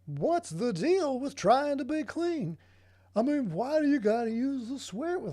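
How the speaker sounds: hammed-up observational comic, pitch swooping up; mock stand-up comic. Mocking clean comics' complaints about swearing, in a loud hammed-up comic voice.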